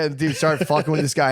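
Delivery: ordinary talk, nothing marked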